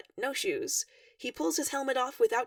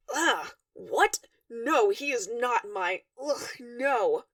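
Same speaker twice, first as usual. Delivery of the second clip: appalled groaning denial, slower; disgusted recoil; 3 dB louder, much slower than usual. A narrator's reading. Dave recoiling from the idea that Durka is his Moira. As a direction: push the idea away with an ugh.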